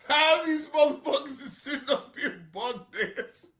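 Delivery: talking through laughter, pitch up high; can't stop laughing while talking. Real, not acted. Streamer cracking up over fans buck dancing, laughing.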